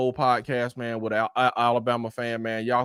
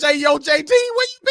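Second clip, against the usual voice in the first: high, loud hey yo call; loud hype greeting; shouted greeting; 13 semitones higher, 4 dB louder than usual. Real, not acted. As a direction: hail a buddy walking in. Calling out to arriving friend.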